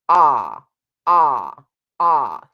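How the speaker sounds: loud open ah, flat tongue, space in the back. Shown as a demonstration. Vocal coach demonstrating an open vowel to students. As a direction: sing "ah" with teeth apart and tongue flat. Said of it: "To get a really good sense of your vowels".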